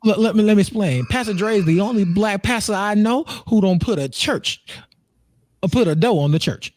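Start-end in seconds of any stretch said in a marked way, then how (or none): none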